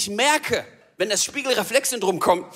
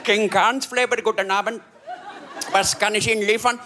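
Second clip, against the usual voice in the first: imitated Indian restaurant phone greeting; Indian accent. Restaurant worker answering a delivery call, Indian accent. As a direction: take an order in a comic Indian accent. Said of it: "geht meistens ein Inder ans Telefon".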